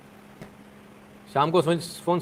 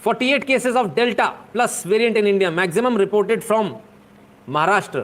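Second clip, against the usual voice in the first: very slow, higher, louder, word by word; headline read; 5 semitones higher, 4 dB louder, much slower than usual. Pretend. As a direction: announce it like a solemn news anchor. Reading out a news headline.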